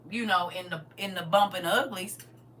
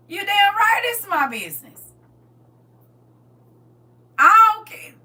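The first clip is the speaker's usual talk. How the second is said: higher and louder, building to a shout; indignant outburst; 10 semitones higher, 8 dB louder than usual. Real, not acted. Telling off a partner about his business, loud and indignant. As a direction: fire it back, done with the nonsense.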